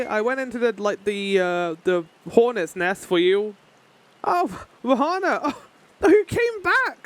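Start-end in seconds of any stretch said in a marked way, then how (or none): 4.2-7.0 high, drawn-out mock astonishment; acted amazement. Greeting Rahana, who came back, with hammed-up amazement. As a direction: gasp it out high and slow.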